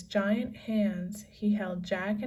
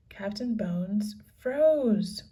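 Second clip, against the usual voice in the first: usual speaking voice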